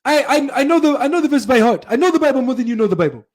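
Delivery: fast, high-pitched boastful mimicry; Zakir Naik, intimidating. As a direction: rattle it off high to intimidate a challenger. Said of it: "intimidate the brother".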